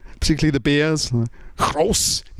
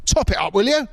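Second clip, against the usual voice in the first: higher-pitched, put-on irate pub drinker; mock indignant customer; 5 semitones higher than usual. British drinker demanding his pint be topped up, mock indignant. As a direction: complain in rising comic outrage. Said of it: "you'd be like".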